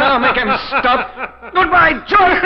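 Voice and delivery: high, loud cackling farewell; evil laughter. Acted. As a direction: laugh triumphantly as you push. Killer laughs pushing victim.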